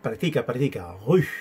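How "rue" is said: "Rue" with tight rounded lips; "Rue" with a pursed, puffed French u; French U sound. Shown as a demonstration. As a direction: blow the u of "Rue" through closed, rounded lips. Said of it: "Los labios cerrados y como que soplas".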